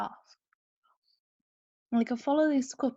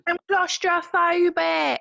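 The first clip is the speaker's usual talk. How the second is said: high, loud, slow diva whine; diva impression; 8 semitones higher, 7 dB louder, slower than usual. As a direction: wail it like a reality TV star.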